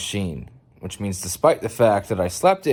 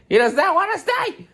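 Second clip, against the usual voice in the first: much higher, squealing; squealed exclaim; 4 dB louder than usual. Real, not acted. Owner squealing as the cat runs off.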